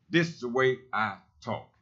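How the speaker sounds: ordinary delivery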